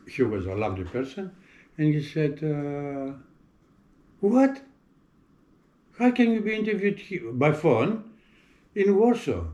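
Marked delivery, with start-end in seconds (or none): 4.2-9.4 retold disbelief, pitched high; a shocked ambassador's high-pitched what?; incredulous surprise. Ambassador baffled by an interview by phone, high and incredulous.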